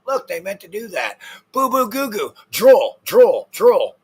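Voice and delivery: high, loud mocking dopey voice; mock the fools as drooling babies; dumb idiot voice. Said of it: "they probably think it's".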